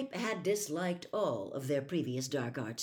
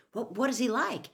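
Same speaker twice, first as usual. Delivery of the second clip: eager; 7 semitones higher, 5 dB louder than usual. Acted. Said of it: "said Harry eagerly".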